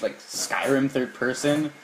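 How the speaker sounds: ordinary delivery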